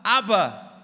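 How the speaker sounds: high, loud, eager call for dad; excited kids shouting for their dad; children shouting. Retold or imitated. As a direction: call out to your father across the room. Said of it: "And they shouted".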